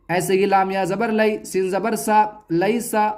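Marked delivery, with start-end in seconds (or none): none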